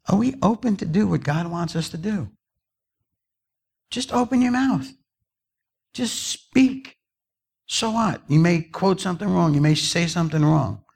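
high-pitched, urging preacher; impassioned pulpit appeal; preaching exhortation. Real, not acted. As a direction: exhort the congregation, pitch lifted well up. Urges congregation to speak up.

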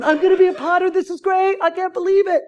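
high, marveling to oneself; acted thrill at great news; giddy excitement. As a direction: gush in mock wonder, up high. Acted delight while driving home.

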